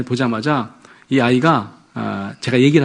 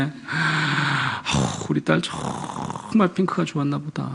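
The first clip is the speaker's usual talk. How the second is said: softer, slow comforting voice; gentle empathy; 9 dB quieter, much slower than usual. Retold or imitated. Father to daughter, soft and slow empathy. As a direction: soothe the daughter kindly.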